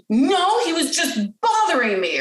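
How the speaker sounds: put-on gasp, then a high indignant child; protesting child. Child defends hitting to parent, indignant imitation. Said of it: "the child will usually say".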